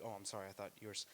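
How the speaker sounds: quick, soft embarrassed apology; hurried polite sorry to a stranger; awkward apology. Retold or imitated. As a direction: mumble it fast, embarrassed. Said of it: "the social awareness to be like".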